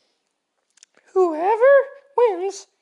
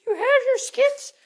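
usual speaking voice

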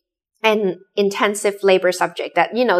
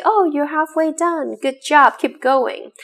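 higher-pitched, encouraging game voice; praising; 7 semitones higher than usual. Retold or imitated. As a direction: cheer a player on, imitating the game. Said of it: "constant praising and pushing factor".

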